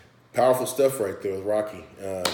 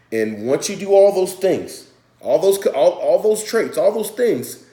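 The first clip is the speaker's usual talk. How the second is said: much higher and louder, slow and insistent; rising emphasis; 9 semitones higher, 8 dB louder than usual. Real, not acted. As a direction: drive the point home. Building emphasis.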